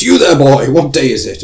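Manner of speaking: hammed-up Victorian old man yelling down the street; old man calling out. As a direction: hail the boy like Scrooge at the window. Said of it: "an old man sticks his head out and goes".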